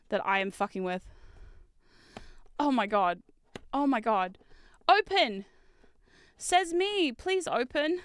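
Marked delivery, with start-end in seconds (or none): 4.9-8.0 raised-pitch plea, spaced out; playful begging; pleading command. Real, not acted. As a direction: coax a stuck box to open. Pleading with the stuck box.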